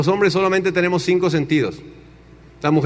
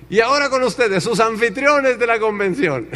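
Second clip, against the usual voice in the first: convention announcer; 5 semitones higher than usual. Retold or imitated. Announcer presenting the convention hosts, high showy voice. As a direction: announce the stars with a big build.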